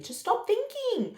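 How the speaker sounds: slow, high, pleading "Just stop thinking"; insistent coaching, pitched high. Retold or imitated. Midwife coaching her to relax.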